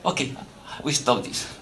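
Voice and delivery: quiet, meek surrender; a hint of a cowed temple president caving; meek giving in. Temple president quietly caving to a donor's threat, meek. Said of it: "I say".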